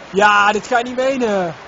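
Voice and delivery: loud, slow disbelief; can't believe this happened; exasperated shout. Real, not acted. Gamer exasperated at failure.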